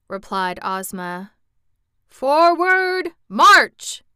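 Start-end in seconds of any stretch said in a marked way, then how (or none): 2.1-4.0 high, loud marching order; crying out. A narrator's reading. Generals ordering the charge, loud high cry. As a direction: bellow the command. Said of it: "cried all the generals".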